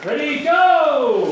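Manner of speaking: slow, high called-out cue, laughing; drawn-out call. Real, not acted. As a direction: stretch out the call to start the take. Director cues the actor's take.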